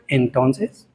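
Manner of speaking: low, leading "well, then?"; pointed retort. Acted. Preacher answering a young man's excuse, pointedly questioning. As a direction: drop low and let it rise questioningly.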